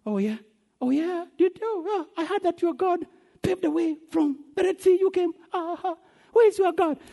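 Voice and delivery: high-pitched taunting disbelief; mocking onlookers. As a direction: jeer at them with a high mock-amazed voice. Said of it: "These people are mocking us".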